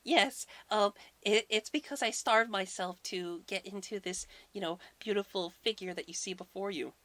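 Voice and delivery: high put-on lady voice; fake voice. Thor disguised as Freya excuses his appetite, high fake voice. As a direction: pitch it up like a man faking a bride. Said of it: "imagine him doing a fake voice".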